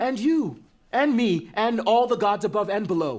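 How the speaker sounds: high, loud, heated defiance; furious shout. Acted. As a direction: yell it right back at him. Son shouting back.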